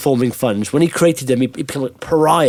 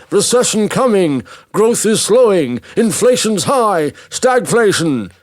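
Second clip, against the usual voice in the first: higher-pitched, drawn-out mock headlines; news outlets; 6 semitones higher, slower than usual. News outlets report economic doom, in high, stretched mock headlines. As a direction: proclaim each alarm like a news headline, slowly. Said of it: "all the sort of news outlets are saying".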